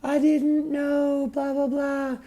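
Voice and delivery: very high, slow, mocking squeal; slow high put-on voice mocking the other woman; mock innocent high voice; mocking girly voice. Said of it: "And she's like".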